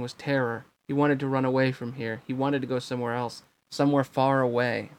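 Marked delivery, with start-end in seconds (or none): none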